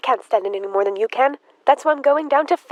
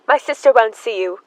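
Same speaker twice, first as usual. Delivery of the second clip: ordinary talk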